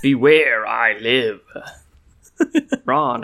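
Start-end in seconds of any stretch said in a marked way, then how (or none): 0.0-1.4 echoing arcade villain; booming robot voice. Retold or imitated. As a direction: boom it like a game boss. Quoting Sinistar game villain.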